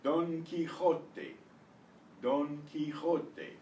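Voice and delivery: "Don Quixote" as dohn kee-KHOH-teh, slowly; Spanish pronunciation. Shown as a demonstration. Teacher modelling for students, slow Spanish "Don Quixote", kee-KHOH-teh. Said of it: "Not Don".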